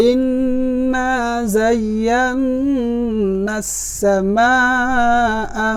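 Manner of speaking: very slow chant, long held nasals and vowels; elongated Quranic recitation. Shown as a demonstration. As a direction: join the words, stretching each long sound. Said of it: "আবার বলছি". (টেনে টেনে একসাথে তিলাওয়াত)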